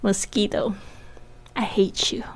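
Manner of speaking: half-joking grudge at a pesky mosquito; soft, slow pretend resentment; mock grumble. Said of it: "真的是很气".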